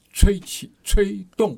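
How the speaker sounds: 吹 words lifted high and pressed; stressed verbs. Shown as a demonstration. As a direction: punch 吹起 and 吹动 as a demo. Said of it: "它动词".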